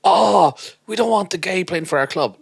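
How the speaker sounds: high, loud mock complaint; mock outcry. Club members objecting to their player, mock outraged and high. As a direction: groan the objection out, hammed up.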